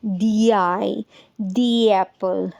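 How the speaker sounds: "दी I" and "दी एप्पल" slow and linked; "दी" before vowel, slow and joined; slow pronunciation demo. Shown as a demonstration. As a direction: model the linked "दी" slowly. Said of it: "ये बन जाएगा दी ink".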